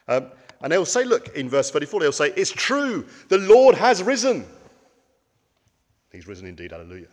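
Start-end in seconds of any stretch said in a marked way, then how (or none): 2.4-4.5 high, loud joyful cry of the news; excited exclamation. Acted. Disciples exclaim resurrection news. 6.1-7.1 soft liturgical reply. Acted. Liturgical response, quiet aside.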